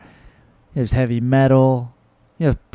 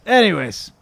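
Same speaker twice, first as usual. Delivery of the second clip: higher, falling restart; lifted segue; 5 semitones higher than usual. Real, not acted. Host moving on to fan questions, lifted segue. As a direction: lift it to restart the show. Repeats himself to restart the show.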